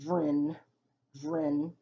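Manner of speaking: "dren" said slowly on its own; isolated syllable. Shown as a demonstration. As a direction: sound out "dren" with an E close to I. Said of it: "E almost sounds like it's making the I".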